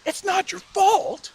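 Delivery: very high, drawn-out disbelief; acted incredulous fury; rising anger. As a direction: throw it back in slow, squealing outrage. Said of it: "the anger rose up".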